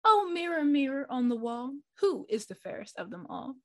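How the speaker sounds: a hint of a vain queen asking; queen asking the mirror. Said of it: "asked the mirror".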